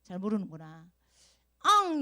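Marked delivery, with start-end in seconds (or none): 1.6-2.0 loud, high mock wail; a sudden pretend bawl; imitated child's cry. Teacher asks why Sua cries, high mock wail. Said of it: "왜 울까?".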